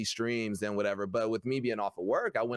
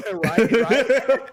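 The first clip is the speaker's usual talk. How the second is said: laughing; 9 semitones higher, 11 dB louder than usual. Real, not acted. Laughing at streaming the birth joke.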